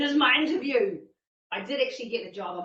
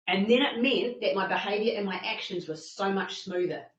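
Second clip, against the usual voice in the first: ordinary delivery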